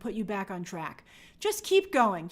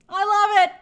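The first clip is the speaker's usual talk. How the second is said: higher and louder, belting it out; loud gush; 10 semitones higher, 11 dB louder than usual. Real, not acted. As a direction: throw it out big and gleeful. Gushing over Slytherin fans.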